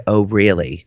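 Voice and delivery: low, cool "oh, really"; sardonic disbelief. Retold or imitated. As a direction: drop low, ominously unimpressed. Reacting to an accusation.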